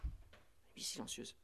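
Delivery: soft whisper, slowed down. Shown as a demonstration. Priest whispering the silent night to his listeners, slow and hushed. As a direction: hush it like the night itself.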